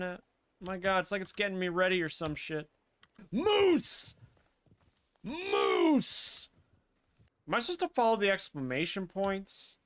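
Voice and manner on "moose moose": drawn-out call with swooping pitch. Real, not acted. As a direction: call out to a moose, stretching the vowel. Calling out at a moose.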